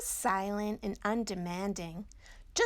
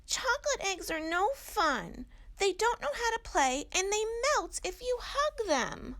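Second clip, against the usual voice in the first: high, louder little owl voice; a small child setting a parent straight; Little Owl explaining; 12 semitones higher, 4 dB louder than usual. Acted. Little Owl tells Mommy chocolate eggs are no fun, high and childlike.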